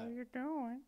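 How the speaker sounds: put-on high, creepy old-man impersonation; old man from Family Guy. Greeting Chris as the cartoon old man, high old-man voice. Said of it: "old man from Family Guy".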